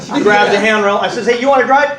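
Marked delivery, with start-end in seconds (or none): 1.3-2.0 louder, higher, sarcastic offer; husband snapping at nervous wife. Retold or imitated. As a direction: needle her with mock politeness. Retold sarcastic driving quarrel.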